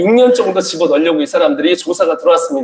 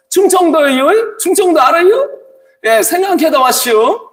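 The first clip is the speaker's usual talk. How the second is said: mock Chungcheong accent; 10 semitones higher than usual. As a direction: stretch it out like a Chungcheong local. Mock Chungcheong speaker in quiz.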